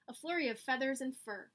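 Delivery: ordinary delivery, nothing marked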